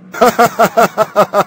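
high mischievous giggle; sneaky high giggle. Acted. Thief laughs at his plan.

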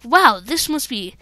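ordinary talk, nothing marked

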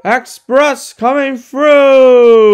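booming warning call; loud, slow proud shout. Acted. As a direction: bellow it out slow and high. Gordon races down the line.

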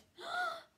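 very high gasping cry; horrified gasp. Acted. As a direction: gasp in horror. Square wakes up wet.

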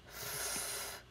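"f" as a long soft hiss of air; isolated sound. Shown as a demonstration. Teacher giving the first sound, "f" held. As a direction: hold the "f" alone without any vowel. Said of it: "First sound".

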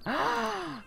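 sharp high excited gasp. Real, not acted. Player thrilled at a celebrity wedding, sharp gasp. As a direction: gasp in delight.

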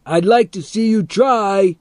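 high, loud taunting shout; shouted back. A narrator's reading. Teddy dares the man across the fence, shouting back. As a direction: yell back a high-pitched dare. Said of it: "Teddy shouted back".

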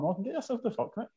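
high-pitched mock outrage; retold indignant refusal. Telling off a pizza thief on the bus, high mock outrage. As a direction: act out an incredulous high-voiced brush-off.

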